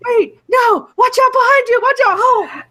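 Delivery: very high, loud acted panic; alarmed warning shout. Viewer warning the officer of danger behind her, acted alarmed shout. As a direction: yell a warning at the screen.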